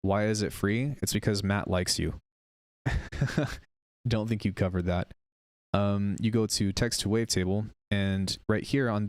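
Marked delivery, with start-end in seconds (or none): 2.8-3.6 short amused chuckle; brief light chuckle to yourself; laugh. Real, not acted. Streamer amused by the chat, short chuckle.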